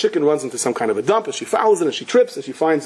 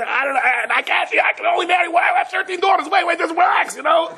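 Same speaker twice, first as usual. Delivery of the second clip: much higher, shouting over a crowd, overwhelmed; frantic high shout. Retold or imitated. Rich man swamped by fathers of sons, panicked high shout.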